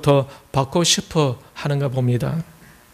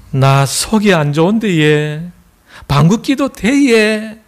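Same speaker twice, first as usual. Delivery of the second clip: Gyeongsang woman; 3 semitones higher, slower than usual. Acted. Gyeongsang woman asking her man's permission, slow Gyeongsang drawl. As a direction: ask hesitantly in broad Gyeongsang dialect. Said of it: "경상도 여자".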